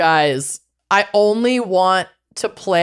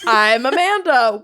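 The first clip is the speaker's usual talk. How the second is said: ordinary talk, nothing marked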